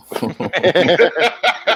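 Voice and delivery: a big rising laugh that breaks out; real laugh, climbing in pitch; hearty laugh. Real, not acted. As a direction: burst out laughing at the joke. Laughing at a quoted joke.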